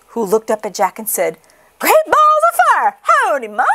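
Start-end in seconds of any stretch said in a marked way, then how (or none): 1.8-3.6 put-on very high, loud baby cry; very high baby goose howdy; newborn goose. Newborn gosling greets Jack.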